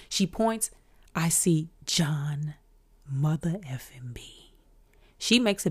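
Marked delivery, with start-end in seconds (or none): 1.2-4.3 slow, whispered disbelief at seeing an idol; awed hush. Retold or imitated. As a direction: drop to a drawn-out, starstruck whisper. Awed sighting of her idol.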